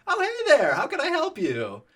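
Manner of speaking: fake casual greeting. Greeting the park ranger while hiding heroin, fake cheer. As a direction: act innocent and cheery. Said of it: "pretend I'm putting a socket".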